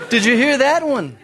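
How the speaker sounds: very high whooping amazement; mock impressed crowd. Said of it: "everybody's like".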